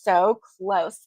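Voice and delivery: usual speaking voice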